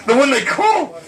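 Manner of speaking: high, startled repeat of the phrase; alarmed cry. Retold or imitated. Mother suddenly realizing, high startled cry. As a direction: blurt it out in alarm.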